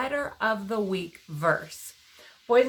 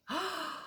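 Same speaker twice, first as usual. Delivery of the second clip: gasp; 9 semitones higher, 14 dB quieter than usual. Real, not acted. Delighted reaction to shout.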